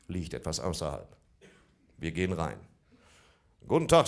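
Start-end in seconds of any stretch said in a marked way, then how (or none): 3.7-4.1 loud, higher cheery hello; chirpy greeting. Retold or imitated. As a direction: sing out a bright shop greeting.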